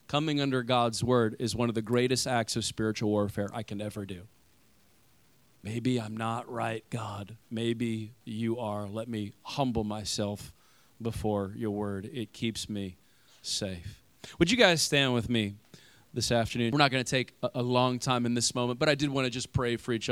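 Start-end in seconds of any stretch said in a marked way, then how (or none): 5.6-14.0 soft, slow, low prayer; humble prayer. Acted. Pastor modeling a prayer of surrender to God, soft and slow. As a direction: pray it humbly, almost to yourself.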